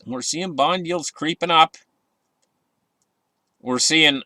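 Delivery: normal talking voice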